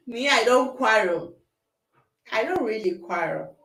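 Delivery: ordinary talk, nothing marked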